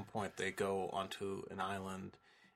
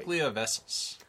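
louder, higher mock-Russian quote; mock Russian accent; 5 semitones higher, 8 dB louder than usual. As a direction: put on a comic Russian accent. Joking film-quote accent on vessels.

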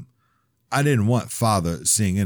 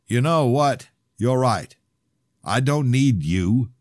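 louder, higher, slow final blow; cold anger; 7 semitones higher, 5 dB louder, slower than usual. Acted. Father snapping back at his son, loud and slow. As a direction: bite each word out slowly.

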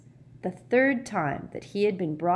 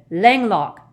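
loud, commanding cast; sharp spell incantation; 4 semitones higher, 11 dB louder than usual. Acted. Harry aiming his wand at Peeves, loud command. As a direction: fire the spell out forcefully.

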